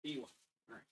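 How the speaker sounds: quietly reading labels under breath; muttering to self. Real, not acted. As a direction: murmur the part names to yourself. Murmuring part labels to self.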